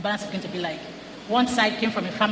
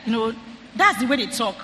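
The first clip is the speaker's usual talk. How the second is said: ordinary talk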